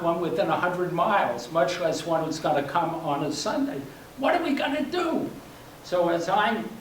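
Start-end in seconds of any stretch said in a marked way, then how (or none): 4.2-5.3 high-pitched, mock-panicked question; agitated. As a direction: pitch jumps up in retold agitation. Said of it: "getting more and more agitated".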